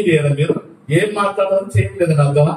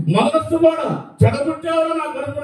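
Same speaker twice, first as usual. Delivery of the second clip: angry mother; 7 semitones higher than usual. Retold or imitated. Mother scolds condemned son.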